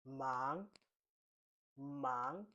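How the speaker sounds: Mandarin second tone on MANG, drawn out upward. Shown as a demonstration. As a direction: let MANG climb like a question. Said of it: "So the second tone".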